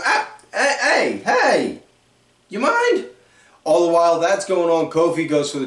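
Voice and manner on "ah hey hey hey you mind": high-pitched indignant "hey, you mind?"; rising, put-out protest; mock annoyed Gable. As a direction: brush off a pest with a squeaky huff. Gable shrugs off Woods' trip.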